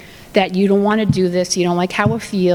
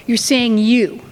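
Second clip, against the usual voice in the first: usual speaking voice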